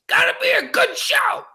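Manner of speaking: hype shout. Real, not acted. Hyping up the show opener.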